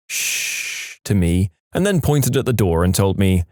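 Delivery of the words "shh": soft, breath-only shush; quiet warning shush; whispered shush. A narrator's reading. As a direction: hush someone with a long shh.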